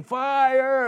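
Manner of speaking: high, loud yell of alarm; waking his cellmate to a burning cell, loud yell; screamed fire. Retold or imitated. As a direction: shout fire in a panic. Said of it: "so I scream".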